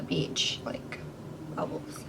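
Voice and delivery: hushed, secretive telling; whisper. Real, not acted. Telling viewers about beach bubbles, whispering. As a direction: lean in and whisper it.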